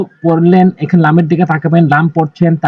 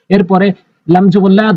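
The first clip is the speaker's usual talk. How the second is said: ordinary talk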